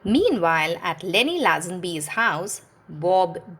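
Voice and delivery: ordinary delivery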